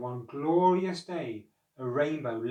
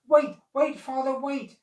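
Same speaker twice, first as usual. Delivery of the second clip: high, loud plea; sons crying out; 11 semitones higher, 8 dB louder than usual. A narrator's reading. Noah's sons crying out to him, high and loud. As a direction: beg your father to stop. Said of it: "cried his sons".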